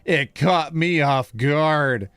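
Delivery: put-on very slow, drawn-out boyfriend voice; boyfriend's voice. Boyfriend texting girlfriend after leaving, slow put-on voice. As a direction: drag out each word of his reply.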